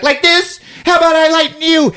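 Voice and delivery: very high mock-angry screech; pissed off. Duck points her gun at him, imitated high angry screech. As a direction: fire it back in a shrieked imitation. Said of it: "she gets pissed off".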